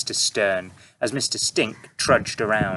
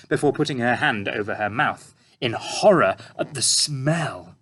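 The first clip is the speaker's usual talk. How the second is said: usual speaking voice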